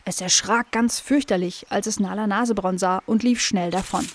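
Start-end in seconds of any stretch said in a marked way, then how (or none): none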